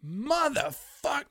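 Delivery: very high, slow drawn-out curse; dismayed curse. Retold or imitated. Fan watching the race slip away, slow high curse. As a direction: drag the curse out in disbelief. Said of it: "And I went".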